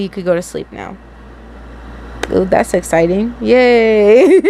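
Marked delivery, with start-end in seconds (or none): 3.4-4.4 excited cheer. Real, not acted. Cheering over exciting plot.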